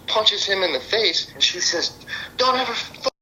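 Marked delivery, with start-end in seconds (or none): 2.4-3.1 imitated angry warning. Girlfriend warning boyfriend off her dad, imitated anger. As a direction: imitate her snapping in a raised pitch.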